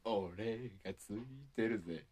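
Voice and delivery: slow, smug tough-guy line; cool guy voice. Pretend. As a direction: drawl it like a cheesy hero. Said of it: "そういうのはいらんけど". (キザな決めゼリフ風)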